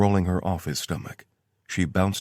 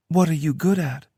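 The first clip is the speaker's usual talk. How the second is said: lightly higher woman's voice; Tara voice; 11 semitones higher, 6 dB louder than usual. A narrator's reading. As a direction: read her lines a bit higher. Tara's voice in story.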